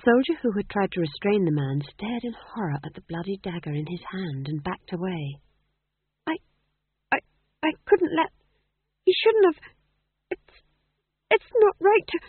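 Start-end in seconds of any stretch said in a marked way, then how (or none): 6.3-12.2 panicked stammer, much higher and louder; stuttering. Acted. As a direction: trip over the words in terror. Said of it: "he stuttered".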